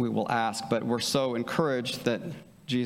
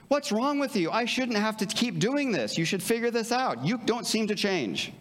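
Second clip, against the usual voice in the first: put-on higher, fed-up scolding of a child; exasperated parent; 8 semitones higher than usual. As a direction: sound at the end of your rope with the kid.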